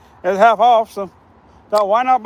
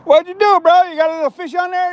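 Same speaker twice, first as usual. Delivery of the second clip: calling out; 8 semitones higher, 4 dB louder than usual. Real, not acted. Calling out to Alex downriver.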